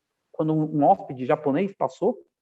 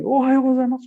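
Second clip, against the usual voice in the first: higher, louder greeting, acted out; saudação efusiva encenada; 7 semitones higher, 5 dB louder than usual. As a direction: blurt out the Japanese greeting before he can. Antecipa-se e assusta o hóspede japonês. (saudação repentina, alta e efusiva, encenada)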